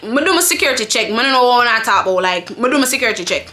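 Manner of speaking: quick patois retort; retold comeback in quick patois; Jamaican patois. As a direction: argue back fast in Jamaican patois. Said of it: "I'ma say this in patois".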